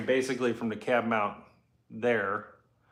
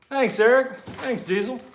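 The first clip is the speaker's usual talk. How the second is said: calling out; 11 semitones higher, 6 dB louder than usual. Real, not acted. Thanking commenters.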